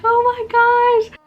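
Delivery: high, loud, slow laugh-crying exclamation; overwhelmed joy breaking into tears; hysterical laugh cry. Real, not acted. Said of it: "weird hysterical laugh cry thing".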